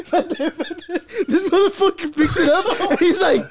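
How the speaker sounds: high, cracking-up storytelling; helpless laughing. Real, not acted. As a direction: squeeze the story out through laughing fits. Cracking up while telling story.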